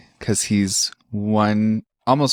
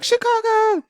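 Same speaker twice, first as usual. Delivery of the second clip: imitating boyfriend's answer; slower than usual. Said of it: "And he's like".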